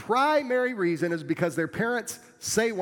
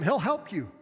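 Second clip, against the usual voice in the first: ordinary delivery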